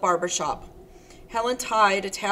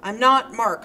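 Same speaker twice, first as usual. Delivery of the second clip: loud, urgent shout; a loud, insistent denial; panicked shouting; 3 semitones higher, 9 dB louder than usual. Acted. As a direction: keep shouting, fighting off panic. Randy shouting Helen off.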